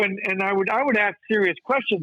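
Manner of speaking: usual speaking voice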